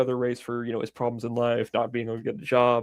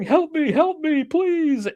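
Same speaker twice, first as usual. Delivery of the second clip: mock pleading; 14 semitones higher, much slower than usual. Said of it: "They're going".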